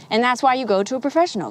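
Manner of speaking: normal talking voice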